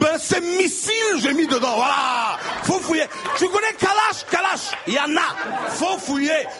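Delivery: unload all the rage, yelling; raging rant. Acted. Comic yelling at the Ivorian policeman, high and furious. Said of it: "toute la rage ... je l'ai versée sur lui".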